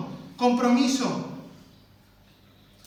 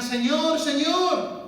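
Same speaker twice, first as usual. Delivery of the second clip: high, loud cry of "Señor, Señor"; desperate kneeling plea; pleading cry; 6 semitones higher, 5 dB louder, much slower than usual. Acted. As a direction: beg from your knees. Kneeling people pleading with Lord.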